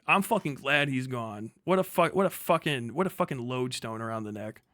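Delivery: ordinary talk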